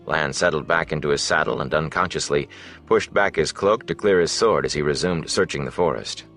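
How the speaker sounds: usual speaking voice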